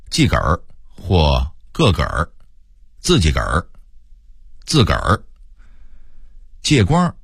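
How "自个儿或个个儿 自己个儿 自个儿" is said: "自己"说成"自个儿"，儿化; 北京腔说"自个儿"; Beijing pronunciation. Shown as a demonstration. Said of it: "北京人会说". (北京话变音说"自个儿")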